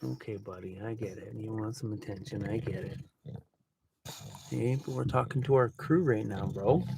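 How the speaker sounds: low, soft and gentle, talking down to a pet; calm the dog down quietly; soothing the dog. Real, not acted. Hushing his needy dog during the live stream, soft and low.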